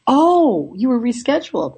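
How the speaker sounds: the agent suddenly gets it; surprised realization. Retold or imitated. Gate agent hears the flight was canceled, high surprised oh.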